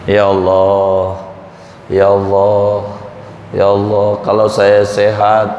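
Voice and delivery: low, whining plea to God; mock pitiful plea to be made well; pleading sick man's prayer. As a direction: beg God for health like a sick man.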